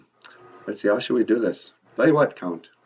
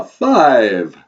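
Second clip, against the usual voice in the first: counting vampire; 8 dB louder than usual. Acted. Count says the English numbers for the host, booming vampire voice. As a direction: announce the number like a theatrical vampire.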